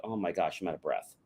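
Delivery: quiet, puffing complaint; winded, wheezy complaint. Retold or imitated. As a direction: sound short of breath. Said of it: "you're like".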